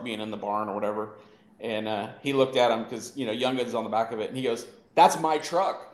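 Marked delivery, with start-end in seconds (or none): 5.0-5.9 slow, loud, laughing declaration; proud shout. Retold or imitated. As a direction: plant your feet and claim the truck proudly. Dad proudly defends his truck.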